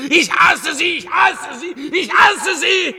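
screamed, high and loud, slowing on the last; furious shout. Acted. Clown screaming his hatred of the rich.

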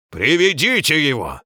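loud, high barked command; barked order. A narrator's reading. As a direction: bark the order. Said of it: "рявкнул Главк".

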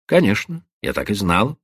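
ordinary delivery

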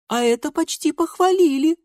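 put-on very high childish chirp; little girl. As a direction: chime in like a small sister. Little sister answers her sister.

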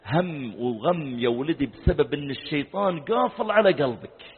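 ordinary delivery

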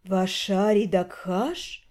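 slow, low, languid drawl; breathy hollywood starlet. Acted. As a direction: purr the name slowly and seductively. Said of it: "Медленно и томно протянула женщина".

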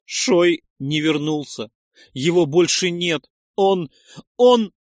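loud, high, distraught outburst; near tears. A narrator's reading. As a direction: grief rising toward tears. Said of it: "И она заплакала".

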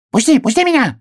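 очень высокий быстрый писк; крикни тоненько, вырываясь; крик ёжика. A narrator's reading. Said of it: "крикнул ёжик". (пискливый крик ёжика)

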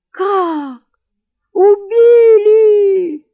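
very high, long-drawn howl; loud, wailed cry of horror; wailing scream. A narrator's reading. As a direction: scream it out and hold the last word. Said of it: "завопил купец".

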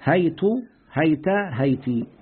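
هيت، هيت، هيتي said slowly, open ha and silent ya, ending in u, a, then i; teacher repeating هيت with each final vowel, slowly; three vowelings. Shown as a demonstration. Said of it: "بفتح الهاء وسكون الياء".

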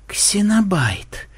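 high, slowly falling stunned murmur; shocked mumble. A narrator's reading. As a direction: breathe the name in shock. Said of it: "потрясённо пробормотала Мелисса". (потрясённое бормотание)

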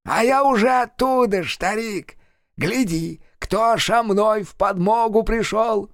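high, gloating hellish voice; cackling hell torturer. Acted. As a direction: gloat like a demon back from hell. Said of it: "расхохотался странно так, будто заклокотал одним горлом".

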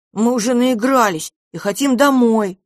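put-on high, loud child dragon; quick insistent little dragon. A narrator's reading. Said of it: "тут же заявил дракончик".

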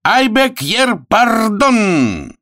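high, stretched-out protest; indignant announcer. Acted. Arthur answers the jeering crowd, high drawn-out protest. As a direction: ring it out high and slow.

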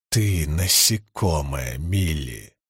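low, slow menacing growl; menacing low snarl. Acted. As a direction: crush her with a low growl. Caridin threatening, head splitting.

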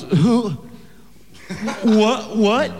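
high, startled stammer; questions jumping high in disbelief; shocked boy. Acted. Boyfriend reacts to the father's shocking suggestion, stunned and high. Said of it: "Bobby goes".

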